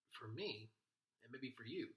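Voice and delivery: ordinary talk, nothing marked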